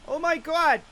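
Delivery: high, loud Boston exclamation; Boston accent. Shown as a demonstration. Streamer as amazed Boston fisherman, Boston accent. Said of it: "Maybe I'll go Boston".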